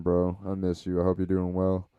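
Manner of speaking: ordinary delivery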